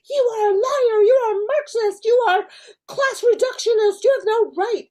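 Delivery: high, loud imitation of a student shouting accusations; mock angry yelling. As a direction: shout it down like an outraged seminar zealot. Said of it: "are just yelling in these graduate seminars".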